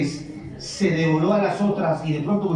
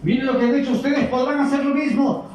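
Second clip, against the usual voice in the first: higher, proud challenging king; haughty pharaoh; 8 semitones higher, 3 dB louder than usual. Acted. Preacher voicing pharaoh challenging sorcerers, higher and scornful. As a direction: dare them like a scornful pharaoh.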